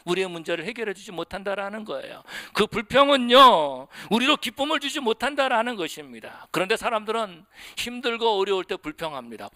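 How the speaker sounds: ordinary talk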